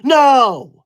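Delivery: outraged denial. Acted. Streamer denying crashing out, outraged denial. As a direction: refuse it with wounded outrage.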